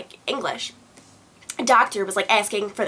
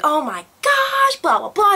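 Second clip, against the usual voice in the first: high, drawn-out mock alarm; panicked mom; 11 semitones higher, 5 dB louder, slower than usual. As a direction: imitate a panicking mother, very high and stretched. Mom reacting to hives, acted out.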